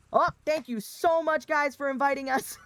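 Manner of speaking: high, loud, gushing mock thanks; dad Midwestern goodbye. Guest leaving Thanksgiving, hammed-up loud Midwestern politeness. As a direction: sing out exaggerated thanks to announce the exit. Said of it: "And I go".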